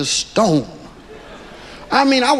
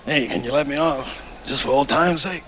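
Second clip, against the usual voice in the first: quiet plea; 7 semitones lower, 5 dB quieter than usual. Retold or imitated. Godfather scene, pleading.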